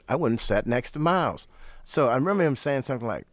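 ordinary talk, nothing marked

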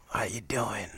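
soft, breathless greeting; imitating Miles's whispered rasp; raspy whisper. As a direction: rasp it barely above air.

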